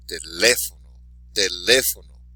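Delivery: Teléfono with a punched "lé"; stress on accented syllable. Shown as a demonstration. Teacher modelling for repetition, Teléfono stressed on "lé". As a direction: hit "lé" in Teléfono, let the rest fall away. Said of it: "be sure to emphasize the accented syllable".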